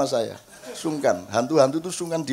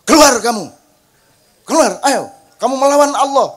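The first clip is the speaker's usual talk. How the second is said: loud, harsh commands, somewhat higher; harsh exorcism; 7 semitones higher, 8 dB louder than usual. Retold or imitated. Exorcist commanding a jinn to leave, harsh and loud. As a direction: snap the orders like a cruel exorcist. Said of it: "Dan semua kejem-kejem".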